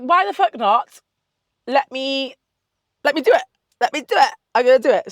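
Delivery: loud, high, pumped-up why the fuck not; psyching herself up to go for it; hyped self-pep talk. Retold or imitated. Telling herself to go for the casting, hyped and loud.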